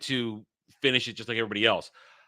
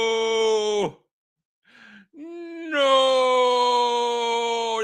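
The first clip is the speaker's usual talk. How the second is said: anguished no; 9 semitones higher, 4 dB louder than usual. Real, not acted. Outraged at spoilers.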